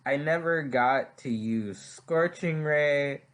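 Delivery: normal talking voice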